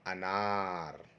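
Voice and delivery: long-held, joined sounds in अनार; slow blending. Shown as a demonstration. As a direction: draw out both syllables of अनार. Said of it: "इसको एक साथ कर देंगे".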